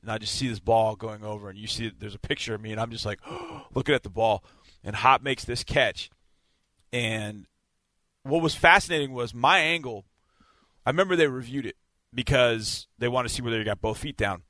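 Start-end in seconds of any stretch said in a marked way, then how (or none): none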